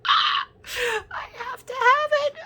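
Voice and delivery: high, loud squealing want; squealing with delight. Retold or imitated. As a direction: squeal like you must have it. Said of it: "I saw this and went".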